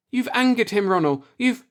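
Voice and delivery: mild alarmed outburst. A narrator's reading. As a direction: blurt out the warning in alarm. Marla alarmed.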